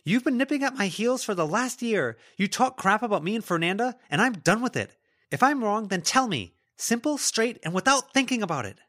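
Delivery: loud, high-pitched accusing tirade; angry outburst. Acted. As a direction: let it all out, loud and fed up. Lila's angry outburst at Melody.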